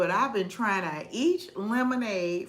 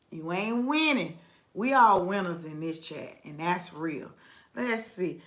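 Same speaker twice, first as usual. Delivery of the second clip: ordinary delivery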